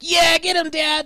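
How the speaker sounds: put-on high whiny kid shout; whiny little kid. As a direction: cheer like a bratty child. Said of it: "a really whiny little kid".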